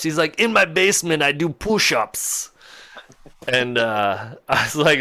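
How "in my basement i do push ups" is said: imitated old Dutchman, slowing at the end; old man's Dutch accent, higher at the start. Old man answers the driver about exercise, Dutch accent. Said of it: "that's definitely what the accent sounded like".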